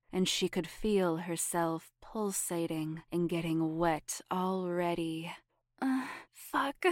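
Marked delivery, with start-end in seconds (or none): 5.8-6.8 high breathy groan. Acted. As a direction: let out a high, strained groan. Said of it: "groaned Sabrina".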